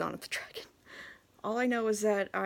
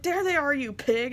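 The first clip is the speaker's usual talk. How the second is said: loud, higher teasing yell of "There they are, you pig!"; mock accusing shout; 6 semitones higher, 6 dB louder than usual. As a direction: catch a friend hoarding candy, loudly. Friend finds hidden candy.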